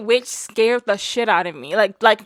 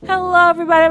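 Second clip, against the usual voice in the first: show host greeting; 8 semitones higher, 7 dB louder, much faster than usual. Acted.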